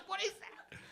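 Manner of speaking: high, hammed-up panicked old composer; shocked Beethoven. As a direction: gasp it out as a baffled Beethoven. Beethoven shown a Vietnamese guy.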